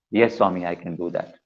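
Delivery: a humble devotee's soft assent; meek reply. Retold or imitated. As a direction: answer meekly, almost under the breath. Devotee meekly agrees.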